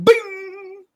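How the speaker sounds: high, springy boing; mock a sudden spring-up; high, springy comic sound effect; cartoon boing. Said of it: "he's like".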